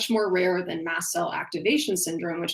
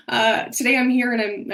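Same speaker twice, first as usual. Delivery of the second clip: higher and louder, voice full of real emotion; emotional; 5 semitones higher, 6 dB louder than usual. Real, not acted. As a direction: push the words out through tears. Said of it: "I didn't expect myself to get emotional".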